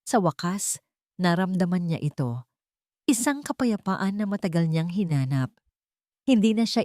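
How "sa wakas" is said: ordinary delivery, nothing marked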